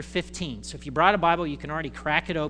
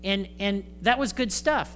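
usual speaking voice

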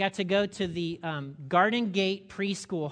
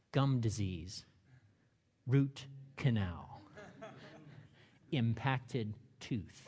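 ominous dread; 7 semitones lower, 6 dB quieter, much slower than usual. Pretend. Dentist's dreaded two-word diagnoses.

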